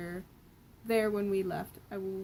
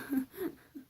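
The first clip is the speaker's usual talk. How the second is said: short high real laugh; quick amused giggle; 6 semitones higher than usual. Real, not acted. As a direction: laugh at your own joke. Reader laughs at own joke.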